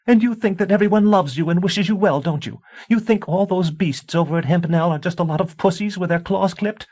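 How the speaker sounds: high, loud screaming tirade; screaming wife. A narrator's reading. A wife screaming warnings at her husband. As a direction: shriek the warning at him. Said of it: "Tansy, stop screaming".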